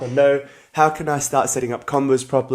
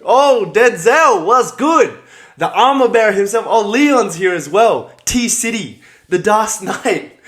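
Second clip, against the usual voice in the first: excited shout-outs; 8 semitones higher, 6 dB louder, slower than usual. Real, not acted. Shouting out viewers joining.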